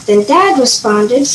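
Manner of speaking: plain reading voice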